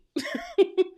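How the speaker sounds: a loud, high laugh; high burst of laughter. Real, not acted. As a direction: break into a squealing laugh. Laughing at own voicing.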